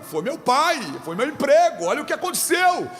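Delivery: pleading congregant. Retold or imitated. Congregant telling pastor of losses, pleading. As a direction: imitate a plaintive listing of losses. Acts out grieving congregant's excuses.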